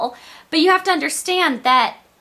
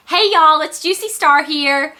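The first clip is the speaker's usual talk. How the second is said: higher, louder Southern greeting; thick Southern accent; 4 semitones higher, 4 dB louder, slower than usual. Retold or imitated. YouTuber imitating her first video intro, thick Southern accent. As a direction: call it out with a Southern twang. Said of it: "using that really thick Southern accent".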